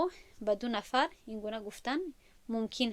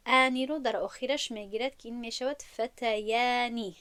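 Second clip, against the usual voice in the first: usual speaking voice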